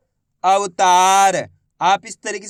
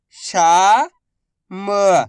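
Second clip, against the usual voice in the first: very slow, each syllable drawn out; much slower than usual. Shown as a demonstration. Teacher sounding out a word for pupils, slow syllables. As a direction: spell the word out slowly for a child. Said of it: "मैं किस तरीके से".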